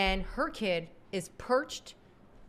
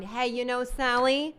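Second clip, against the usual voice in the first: higher, brisk friendly call; mocking United phoning a flight attendant; pretend corporate caller; 4 semitones higher, 5 dB louder than usual. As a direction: sweet-talk Sally with a question. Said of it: "calling the flight attendant".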